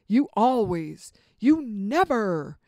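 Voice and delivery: loud, slow mock accusation; accusing absolutes. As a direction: fling the blame at a partner, drawn out. Acting out accusations using absolutes.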